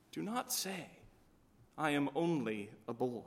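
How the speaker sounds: a quiet, firm repeat of the command; hushed command. A narrator's reading. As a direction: bring the Lord's command down to a hush. The Lord's repeated command, softened.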